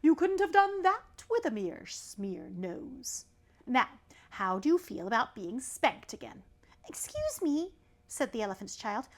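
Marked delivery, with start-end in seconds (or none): 0.0-6.4 slow professorial python, lightly voiced; bespectacled snake. Python rock snake with glasses lecturing the elephant's child. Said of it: "I think of him having glasses". 6.9-7.7 much higher polite little voice; high child voice. Acted. Elephant's child answering the python in a high voice.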